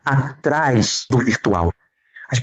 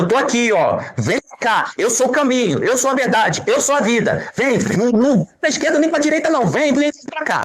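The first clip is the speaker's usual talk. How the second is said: God calling; 8 semitones higher than usual. Acted. Voicing God calling the lost.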